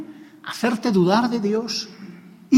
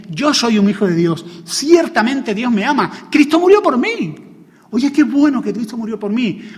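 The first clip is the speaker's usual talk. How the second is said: rising louder and higher to a cry on "murió por mí"; impassioned preaching; 5 semitones higher, 6 dB louder than usual. Acted. Preacher showing the congregation how to preach the Gospel to themselves, building to a fervent shout. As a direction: proclaim it as a triumphant reminder to yourself.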